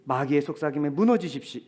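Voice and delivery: normal talking voice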